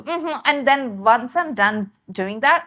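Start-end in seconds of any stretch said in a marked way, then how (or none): 0.0-0.4 soft, high-pitched offhand hum; imitated American mm-hmm. Catching oneself doing it too, imitated American mm-hmm. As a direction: toss off a light, high "mm-hmm". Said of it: "I find myself doing that too".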